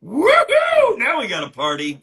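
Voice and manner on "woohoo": loud, high-pitched "woohoo"; excited whoop. Real, not acted. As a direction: whoop it up to greet a newcomer. Greets newcomer arriving at live sale.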